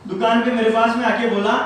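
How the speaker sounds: usual speaking voice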